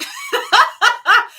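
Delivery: sudden high laugh; high laughing burst. Real, not acted. Laughing at the odd coincidence with viewers. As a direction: laugh at the irony.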